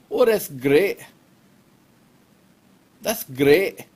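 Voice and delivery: short bursts of put-on Scottish; punchy, amateur Scottish accent; practised Scottish accent. Shown as a demonstration. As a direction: show off your one Scottish phrase. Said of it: "can't say anything else in Scottish".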